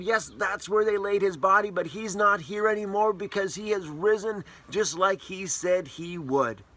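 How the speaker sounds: high, bright guide voice, lightly hammed-up; cheerful tour-guide angel. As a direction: lead the women through the tomb like a friendly guide.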